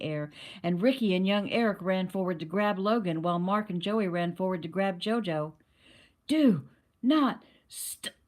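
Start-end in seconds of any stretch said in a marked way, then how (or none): 6.3-8.1 high, loud start strangled mid-word; the words get cut off by an invisible grip; choked off. A narrator's reading. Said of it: "his words were choked off literally".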